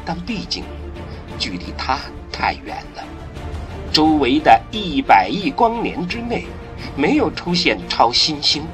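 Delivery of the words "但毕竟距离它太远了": usual speaking voice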